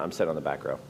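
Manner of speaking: low soft sulky refusal; sulky low grumble. Retold or imitated. Husband refusing to engage, low sulky grumble. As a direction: sulk it out low.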